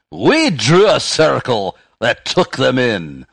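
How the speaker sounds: loud, high, slow hammed-up Scottish recital; booming mock-Scottish delivery, pitched well up; attempted Scottish accent. Shown as a demonstration. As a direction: declaim the verse in a bad Scottish accent. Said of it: "if you did that with a Scottish accent".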